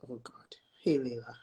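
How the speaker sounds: breathy whispered aside. Real, not acted. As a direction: whisper it under your breath. Muttering about friends joining.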